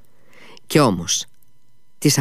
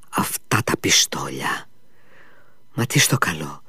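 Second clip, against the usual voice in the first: whisper; 5 semitones lower, 5 dB quieter than usual. Acted. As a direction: breathe it out as a private worry. Clerk's worried inner thoughts.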